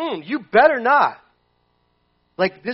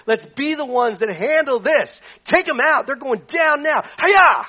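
higher, louder, building to a battle cry; eager for battle; 5 semitones higher, 5 dB louder than usual. Retold or imitated. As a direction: psych up for the fight. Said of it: "Because he's going".

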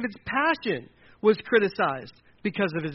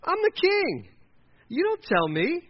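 very high, affronted royal outburst; hammed-up high-pitched indignation; indignant king; 12 semitones higher, 3 dB louder than usual. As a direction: play an offended king putting a boy in his place. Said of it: "if Saul had been like".

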